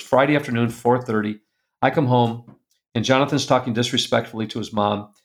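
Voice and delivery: normal talking voice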